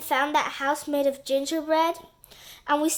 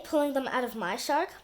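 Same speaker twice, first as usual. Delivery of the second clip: plain reading voice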